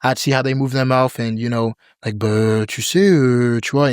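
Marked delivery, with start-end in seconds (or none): 2.2-3.9 lazy French filler, "Bah, tu sais, euh"; French accent imitation. Copying French friends, casual French accent. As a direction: mimic a French speaker's casual hesitation. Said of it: "I would try to imitate it".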